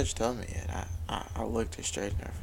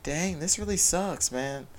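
frustrated groan; 6 semitones higher, 4 dB louder, slower than usual. Retold or imitated. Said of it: "It's okay to be like".

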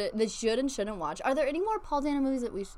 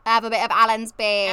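louder hammed-up British accent; mock British accent; 6 dB louder than usual. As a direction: lay the British on thick. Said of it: "he talks with his tongue".

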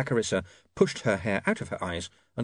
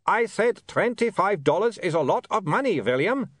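put-on high, insistent accented call; calling over noise; 10 semitones higher than usual. Otto repeats himself over the barking, raised voice. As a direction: repeat it for someone who can't hear.

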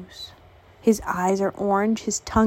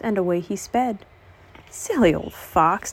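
ordinary delivery, nothing marked

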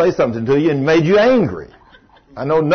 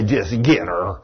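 low, chuckling tempter; a low, sly demon egging him on; half-laughing devil whisper in his ear; 9 semitones lower, 4 dB quieter, slower than usual. Retold or imitated. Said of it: "that demon would say".

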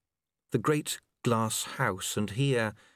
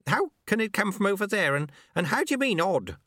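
higher, quick questioning voice; puzzled old master; 9 semitones higher, faster than usual. A narrator's reading. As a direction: fire off baffled questions, pitched up.

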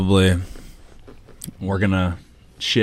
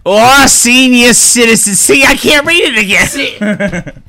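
much higher, slow quavering granny read; old lady voice; 12 semitones higher, 3 dB louder, slower than usual. Pretend. Senior flubbing a commercial line, high slow granny voice. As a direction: stumble over the line like an old actress.

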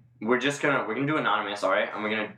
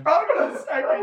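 laughing hard; 5 dB louder than usual. Real, not acted. Cracking up at the attempt.

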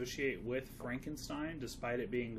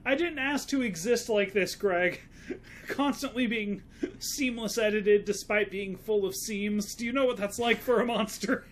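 high, loud, anguished monster whine; 9 semitones higher, 8 dB louder than usual. Acted. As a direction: wail it like a tormented monster. Frankenstein's monster complaining dramatically.

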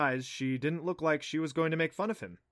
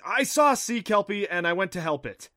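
blurted out; 7 semitones higher, 9 dB louder than usual. Acted. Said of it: "He blurted out".

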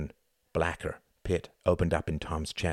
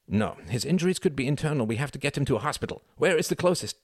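higher, hurried urgency; urgent alarm; 7 semitones higher, faster than usual. A narrator's reading. Klaus insisting on a hospital for Reginald, urgent. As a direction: press them urgently, voice climbing to the question.

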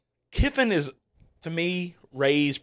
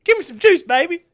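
Jimbo juice voice; 8 dB louder than usual. Retold or imitated. Continuing Jimbo impression.

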